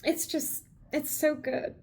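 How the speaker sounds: high, tear-choked praise; tearful gush. Real, not acted. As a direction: gush through the tears. Emotional after tears.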